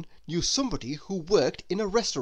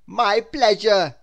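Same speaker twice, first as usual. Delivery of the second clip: slow, louder, friendly; a polite postman; lightly voiced postman; 4 semitones higher, 10 dB louder, slower than usual. Postman replying to Mister Small, lightly voiced.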